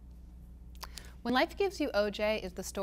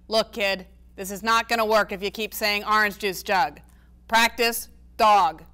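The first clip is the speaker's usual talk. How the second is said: exasperated grandpa; 7 dB louder than usual. A narrator's reading. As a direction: throw up your hands at her. Grandpa Ace voiced in reading.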